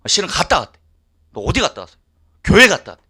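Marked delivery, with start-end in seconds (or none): 1.3-3.0 따지는 외침. Retold or imitated. 아내에게 따지는 부부 싸움.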